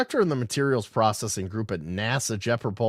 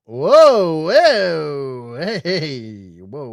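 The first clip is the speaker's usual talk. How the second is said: startled whoa; 4 semitones higher, 9 dB louder, much slower than usual. Real, not acted. Startled by the map on screen.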